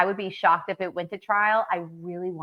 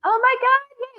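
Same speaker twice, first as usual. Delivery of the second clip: shrieking oh my god high and loud; excited squeal; 4 dB louder, slower than usual. Real, not acted. Friend reveals a surprise book, a delighted squeal. Surprise gift of her name.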